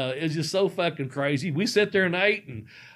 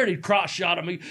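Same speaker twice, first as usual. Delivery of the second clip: normal talking voice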